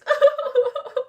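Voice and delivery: high bright burst of laughter; real laugh. Real, not acted. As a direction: laugh out loud at the faces. Laughing at crying faces.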